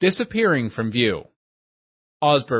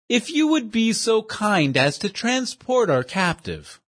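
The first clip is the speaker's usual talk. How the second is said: higher, fussy, overly formal voice; pompous gentleman; 8 semitones higher than usual. Acted. Portly schemer praises his dim companion, high and prissy. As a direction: play a prissy know-it-all praising his henchman.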